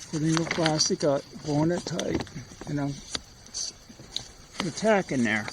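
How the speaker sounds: talking around tacks in mouth; mouth full. Real, not acted. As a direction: speak with tacks held between lips. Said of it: "I'm talking with tacks in my mouth".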